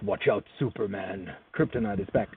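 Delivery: low, menacing warning; lightly voiced villain threat; ominous villain voice.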